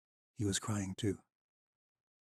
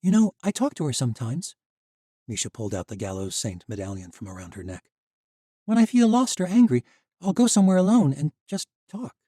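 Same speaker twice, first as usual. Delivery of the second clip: put-on higher young woman's voice for Misha; Misha's voice; 5 semitones higher, 7 dB louder than usual. A narrator's reading. Misha's voice in the story.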